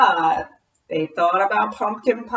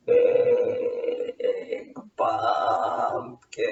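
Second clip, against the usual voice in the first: mummy voice; 5 dB quieter, much slower than usual. Acted.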